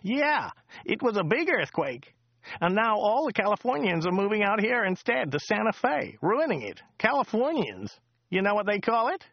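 high, chatty local driver voice; chatty driver Joe. Acted. Driver Joe chatting to Dirk on the drive, high chatty voice. Joe's voice again.

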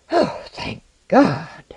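slow, high exhalation of thanks; great relief. A narrator's reading. Traveller back with the alchemist, deeply relieved. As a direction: let relief pour out slowly.

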